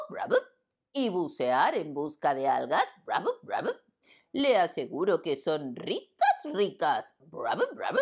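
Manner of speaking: slow reading broken by "Brub" gurgles; bubbling frog reading. Acted. Frog clerk reading the letter, slow with bubbly "Brub" sounds.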